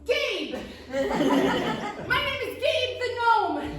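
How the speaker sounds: high, slow, proud gnome voice; gnome Gabe. Acted. Gnome proudly introduces himself to the witch, high and slow. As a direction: announce your name grandly as a tiny gnome. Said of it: "And he says".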